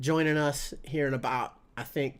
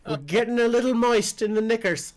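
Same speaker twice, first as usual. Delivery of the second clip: mock British accent, a touch higher; 8 semitones higher, 3 dB louder than usual. Joking about a smooth voice, mock British accent. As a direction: put on a posh British lilt. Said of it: "That's how British dudes talk".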